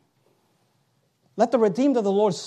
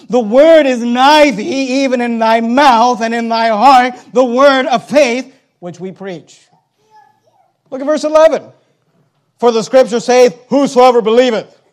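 loud, raised preacher's voice; full-force preaching; 5 semitones higher, 12 dB louder than usual. Acted. Preacher declaring scripture to the congregation, full-force preaching. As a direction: proclaim the verse, loud and drawn out.

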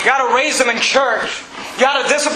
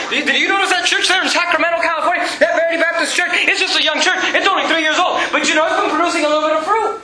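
lightly acted God, pitched up; raised, lively pitch as God points out a church; God talking; 7 semitones higher, faster than usual. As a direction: play God eagerly noticing something. Acting God spotting a fruitful church.

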